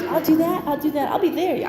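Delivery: high, loud string of eager promises; volunteer for everything at once; hammed-up eager yes-person; mock over-eager promising. Mimics overbooking herself to please.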